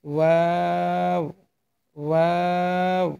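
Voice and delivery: ওয়াও drawn out slowly with both lips rounded; teacher modelling ওয়াও with rounded lips; rounded-lip letter demo. Shown as a demonstration. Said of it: "দুই ঠোঁট গোল করে উচ্চারণ করবেন".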